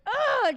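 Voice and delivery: high, acted "ugh" of frustration; frustrated groan. Explaining the need to scream, frustrated groan. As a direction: let out a mock exasperated groan. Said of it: "you're so frustrated with something".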